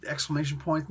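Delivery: ordinary talk, nothing marked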